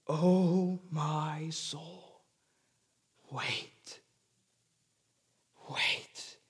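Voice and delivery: slow and soft, fading to a whisper; hushed self-entreaty. Acted. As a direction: quietly coax your own soul, ending in a breath. Preacher acts psalmist entreating his soul.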